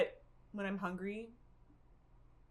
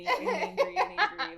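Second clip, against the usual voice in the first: laughing; 10 semitones higher, 5 dB louder than usual. Real, not acted. Laughing about her anger.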